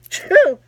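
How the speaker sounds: loud playful fake sneeze; pretend sneeze. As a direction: sneeze it out as a joke. I must be allergic to.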